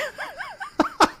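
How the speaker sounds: bright burst of laughter; sudden high giggle; real high laugh. Real, not acted. Amused by literal place names.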